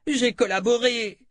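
high, strained plea on the edge of tears; breaking into sobs. A narrator's reading. Gadi bursts into sobs before Kalan, high pleading wail. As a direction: cry it out pleading. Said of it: "Il éclata en sanglots".

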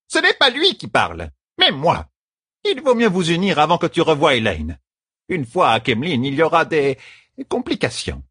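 very high scolding character voice; stern bossy servant. A narrator's reading. Servant ordering the lovers to marry, stern.

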